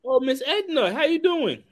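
high sing-song hello; high, sweet hello to grandmother's friend; sweet greeting. Acted. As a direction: lift way up to greet an old lady. Greets elderly woman, sing-song.

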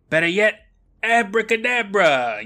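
high, hammed-up magic word; magician's incantation. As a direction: draw out the magic word with a loud flourish. Acted rabbit urging a magic word.

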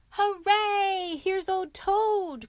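beaming welcome cry, high and loud; joyful cry. A narrator's reading. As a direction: burst out cheering at the sight of Toad. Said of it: "cried the mole".

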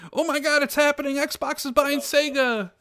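high, excited gasp of hype; mock excitement. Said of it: "and it's like".

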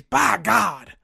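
loud, excited wrestling announcer call; J R impression. Host quoting a wrestling announcer about a brutal game, loud and excited. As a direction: belt it out like an old ringside announcer. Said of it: "to quote the good old J R".

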